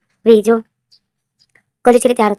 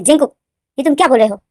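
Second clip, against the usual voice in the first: loud, higher furious outburst; angry; 3 semitones higher, 7 dB louder than usual. Acted. V angrily confronts Jinkook about the bedroom remark. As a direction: shout at him in outrage. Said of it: "वी गुस्से में हो जाता है".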